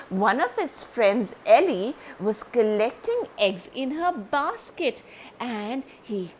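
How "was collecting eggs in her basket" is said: ordinary delivery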